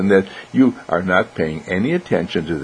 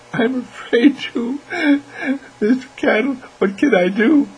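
put-on frightened little girl. Little girl asks Mister Candle for help, frightened. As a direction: plead in a tiny, high child's voice. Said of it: "the little girl looked over at me and she said".

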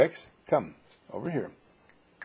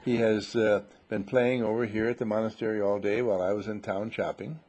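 usual speaking voice

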